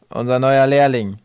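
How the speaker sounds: loud, slow presentation; announcing. Acted. Tuntum presenting Twig to the pirates, announcing. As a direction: introduce him with full voice.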